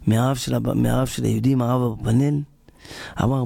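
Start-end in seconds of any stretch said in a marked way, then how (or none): none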